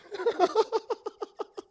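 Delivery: high, breathy burst of laughter; sudden high laughing fit. Real, not acted. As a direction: crack up at your own line.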